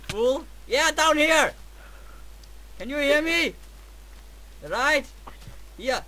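normal talking voice

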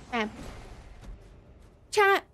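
ordinary talk, nothing marked

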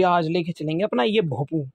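usual speaking voice

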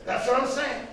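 high, impatient imitated comeback; exasperated insistence. Friend confirms he will be there, exasperated.

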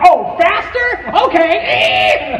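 loud, high mock question, then gleeful okay; hammed-up excited agreement; imitating eager dad. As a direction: shout back as the oblivious driver. Acting oblivious dad speeding up.

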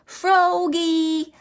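high, drawn-out call. A narrator's reading. Mother calling Froggy from the pond, high drawn-out call. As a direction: call for your child, pitched well up. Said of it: "call his mother".

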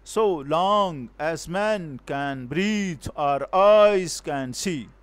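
"So long as man" drawn out slowly, swelling on "long" and "man"; very slow metrical beat, every second word weighted like "long"; iambic scansion. Shown as a demonstration. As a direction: chant it slowly in iambs, leaning on "breathe" and "eyes". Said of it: "How we will study it?".

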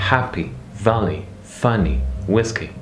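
happy, valley, funny, whiskey, each falling from a stressed start; stress on first syllable. Shown as a demonstration. Teacher listing two-syllable examples, first syllables stressed. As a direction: stress HAP, VAL, FUN, WHIS, then drop away. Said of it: "Première syllabe".

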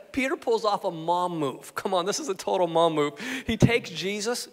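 normal talking voice

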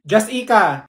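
"Jessica" as juss-TEE-kuh, loud; mispronounced name. Acted. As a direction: read "Jessica" with a wrong middle stress. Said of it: "Did she just call me Jessica?".

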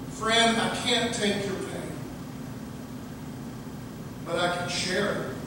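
soft and tender, fading to a whisper; gentle mouse. Acted. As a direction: comfort a hurting friend quietly. Mouse gently comforts the lion.

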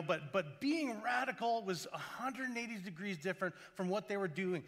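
normal talking voice

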